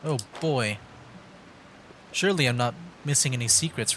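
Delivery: usual speaking voice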